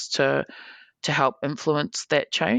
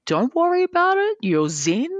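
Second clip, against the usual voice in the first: higher, coaxing reassurance; mock soothing advice; 10 semitones higher than usual. Organiser calming the angry player, higher coaxing reassurance. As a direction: coax him to calm down. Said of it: "and I'm like".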